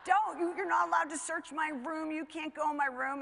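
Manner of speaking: loud, high-pitched retold teenage protest; panicked protest. Son running after mother to stop a room search, loudly protesting.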